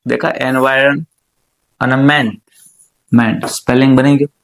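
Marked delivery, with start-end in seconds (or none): 1.9-3.5 મેન as a separate low piece; sounded out. Shown as a demonstration. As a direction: dictate મેન on its own, lower. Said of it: "બોલતા બોલતા લખવાનું". (મેન છૂટું પાડીને, નીચા સૂરે)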